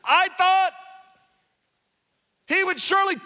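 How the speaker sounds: a proud general fuming at the snub; offended general ranting; indignant complaint. Acted. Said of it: "He goes, look, he says".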